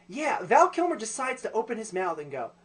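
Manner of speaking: ordinary talk, nothing marked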